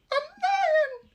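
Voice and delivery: very high, loud startled squeak; frightened cry. Acted. Mouse queen hearing of the lion, alarmed squeal. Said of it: "cried the little queen".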